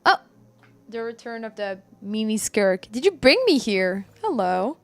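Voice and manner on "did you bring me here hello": ordinary talk, nothing marked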